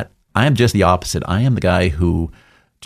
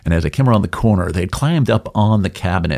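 normal talking voice

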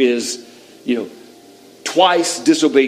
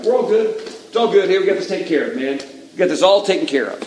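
higher, casual mock all-is-well voice; breezy false reassurance; 5 semitones higher than usual. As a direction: wave away any concern before he looks closer. Saul heading off the prophet, falsely reassuring.

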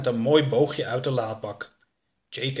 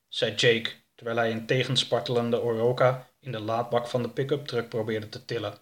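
ordinary delivery, nothing marked

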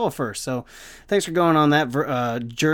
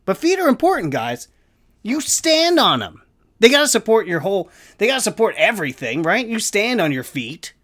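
loud high rant about feet; ranting yell; 8 semitones higher, 5 dB louder than usual. Real, not acted. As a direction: yell it like a man fed up. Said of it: "as I'm yelling about memory foam".

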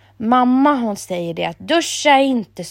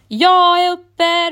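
slow, much higher and louder wake-up call; 9 semitones higher, 7 dB louder, much slower than usual. A narrator's reading. Emil waking at the alarm, calling out slowly and loudly. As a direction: announce it to the whole house. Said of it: "ropar Emil".

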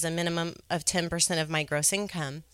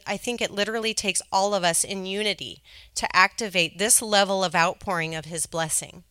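ordinary talk

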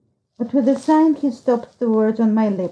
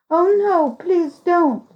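pleading; 7 semitones higher, slower than usual. A narrator's reading. Narrated boy begging her not to.

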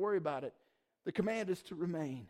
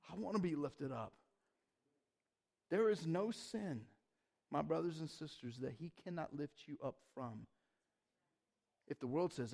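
soft tender preaching; 3 semitones lower, 7 dB quieter than usual. Real, not acted. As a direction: gently comfort the listeners. Tender altar-call moment.

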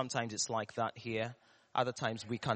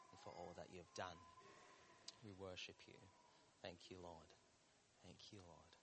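many voices in unison; 5 semitones lower, 23 dB quieter, faster than usual. Real, not acted. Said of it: "let's do that together in one voice".

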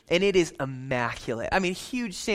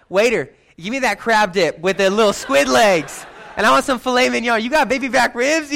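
loud, higher, gleeful orders to the waiter; gleeful ordering; 6 semitones higher, 6 dB louder than usual. Pretend. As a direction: boom it out like a king ordering a feast. Imagined ordering like a king.

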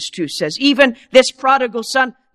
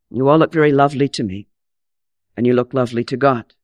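usual speaking voice

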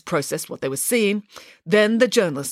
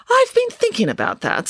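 high; 3 dB louder than usual. Acted. Nanny's bright reply.

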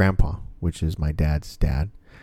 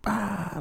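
soft, high imitated scream; high-pitched scream held back in volume; mock scream; 6 dB quieter than usual. Retelling the niece's scream in the dark hallway, mock scream. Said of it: "she just fucking screamed".

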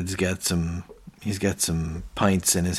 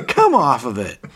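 higher, louder imitation of a frustrated boss; mock exasperation; 4 semitones higher, 6 dB louder than usual. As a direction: scoff in exaggerated protest. Boss outraged at the show of hands.

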